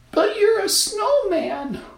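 put-on very high, slow girl voice; little girl voice. A narrator's reading. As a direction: object like a small girl. Girl objects.

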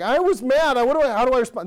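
put-on a small child fumbling for words; little kid voice. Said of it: "where you're like".

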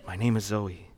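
low, very soft, slowed recitation; imitated hushed rehearsing. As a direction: imitate a child practising lines in secret. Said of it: "like she was rehearsing".

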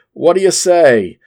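loud, high-pitched question in a bad Australian accent; terrible Australian accent. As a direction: put on a clumsy Australian accent and call it out. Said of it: "my terrible Australian accent coming out there".

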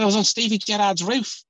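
high, loud, badly faked Scouse; hammed-up Liverpudlian accent, pitched right up; Craig Noone. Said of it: "Oh, sorry, that's really bad".

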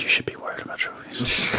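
hushed teasing whisper. Real, not acted.

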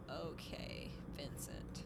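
soft whispered aside. Real, not acted. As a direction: breathe it under your voice. Sarcastic aside about Vincent.

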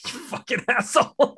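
high, laughing mock outrage; laughing insult. Real, not acted. Host laughing at his cohost's dig about his hair, mock insulted. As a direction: laugh it out, pretend offended.

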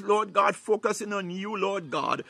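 ordinary talk

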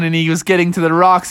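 usual speaking voice

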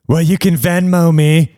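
high, slow mock female voice; exaggerated stripper voice. Persistent stripper suggesting payment by app, exaggerated voice. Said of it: "She didn't actually sound like that".

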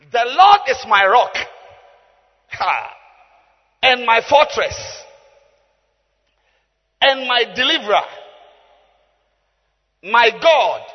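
declaimed scripture. Real, not acted. Preacher reading the psalm to the congregation, forceful declamation. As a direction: proclaim each title with force.

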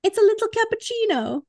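lightly voiced Mrs. Doubtfire; playful high quote; movie quote voice. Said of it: "First one up".

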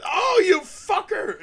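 high, drawn-out mock scream; loud, slow comic howl; imitated pain yell. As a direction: yell as if wax was just ripped off. Acting out a waxing scream.

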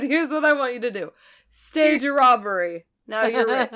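mock grandpa through laughter; laughing joke line. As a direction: deliver the joke while cracking up. Joking version of grandpa's instruction.

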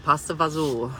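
pasta fazool, stressed on ZOOL; Italian-American pronunciation. Shown as a demonstration. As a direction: end pasta fazool on a long oo and L. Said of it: "It's fazool".

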